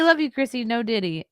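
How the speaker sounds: ordinary delivery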